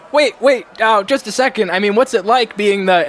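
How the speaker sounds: high, loud, urgent calling; clamoring crowd. Acted. Villagers calling after the new songstress, eager and insistent. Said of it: "a bunch of voices behind you going".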